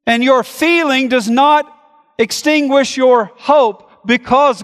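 high and loud, driving sermon delivery; preaching at full force. Acted. Pastor to congregation on God's sovereignty, full-force preaching. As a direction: preach it out hard to the whole room.

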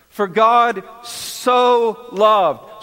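"so" stretched long and weighted, slow; slow, deliberate verse, "so" emphasised; stress on so. Shown as a demonstration. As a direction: press down hard on "so". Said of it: "So is not a throwaway word".

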